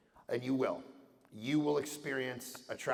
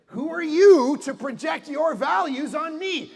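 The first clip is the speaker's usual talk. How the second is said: mock bro shout; 13 semitones higher, 12 dB louder, slower than usual. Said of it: "I go".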